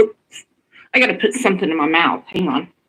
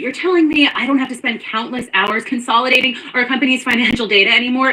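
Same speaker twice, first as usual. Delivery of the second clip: ad voice; 6 semitones higher than usual. Acted. Inserted ad-style clip voice.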